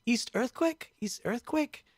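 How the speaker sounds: put-on high-pitched, questioning German accent; high German-accented query. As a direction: imitate a startled German asking in accented English. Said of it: "he had a German accent".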